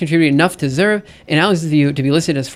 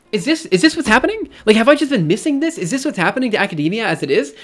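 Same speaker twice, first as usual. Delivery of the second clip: high-pitched, disbelieving questioning; pitched up in disbelief; incredulous; 5 semitones higher than usual. Real, not acted. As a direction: ask it in real bewildered exasperation. Disbelieving questions about academia.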